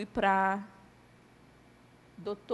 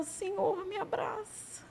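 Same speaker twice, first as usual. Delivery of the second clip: crying prayer; 10 semitones higher, 6 dB quieter, slower than usual. Retold or imitated. Hugging herself, begging the Lord through tears. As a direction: voice breaking high into a sob. Praying alone in the ambulance.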